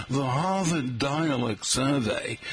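slow Boston accent, dropping the r's. Shown as a demonstration. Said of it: "oh, I should say, like".

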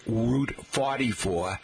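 slow Boston "fotty-foah"; Boston accent. Shown as a demonstration. Host translating directions for locals, Boston accent. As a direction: drop the R's in forty-four, Boston-style. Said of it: "if you Boston accent".

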